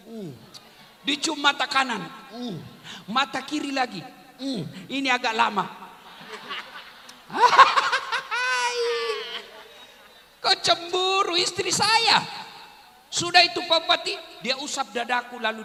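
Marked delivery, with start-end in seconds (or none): 5.7-9.8 loud, high burst of laughter; high burst of real laughter; laughing. Real, not acted. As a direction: laugh out loud at your own story. Audience-facing preacher laugh.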